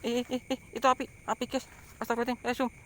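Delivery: high, frantic panicked cries. Real, not acted. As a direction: shriek it in sudden terror. Sees fire, panics and runs.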